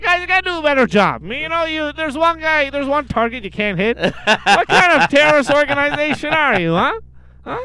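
high, loud, cocky scolding; mocking taunt. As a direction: taunt the terrorists with swagger. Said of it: "He's like".

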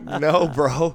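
loud, high-pitched retold protest; incredulous refusal. Dealer retelling his reply to a guest, incredulous. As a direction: turn him down in loud disbelief.

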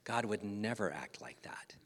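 hushed, breathy whisper of conviction. Acted. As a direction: lean in and whisper it. Solemn point after joke.

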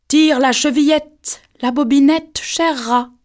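high, loud, slow faked sweet voice; a wolf forcing a gentle granny voice; wolf softening his voice. A narrator's reading. Wolf softens his voice to lure the girl in, high and slow. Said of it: "en adoucissant un peu sa voix".

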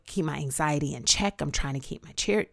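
ordinary talk, nothing marked

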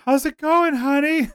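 high sweet call from another room; sweet singsong call. Retold or imitated. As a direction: sing it out sweetly to the kitchen. Retold voice from the kitchen.

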